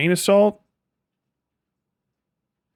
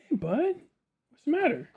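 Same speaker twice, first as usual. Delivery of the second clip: pet talk; 12 semitones higher, 4 dB quieter than usual. Real, not acted. Talking to his dog Buck.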